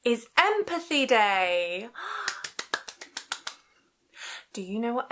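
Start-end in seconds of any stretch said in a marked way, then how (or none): 1.9-3.5 gasp. Acted. Excited gasp at Empathy Day.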